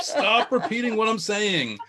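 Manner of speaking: high, slow, drawn-out protest; hammed-up yelling at a stupid phone; exasperated mock yell. Acts out yelling at dictation.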